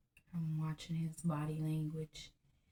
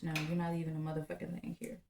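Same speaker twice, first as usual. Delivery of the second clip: fast retold taunt; much faster than usual. Retold taunt at leaving husband.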